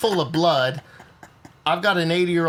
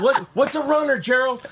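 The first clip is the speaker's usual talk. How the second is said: high, laughing, startled question; alarmed; 11 semitones higher than usual. Retold or imitated. Retelling alarmed question to Gerald.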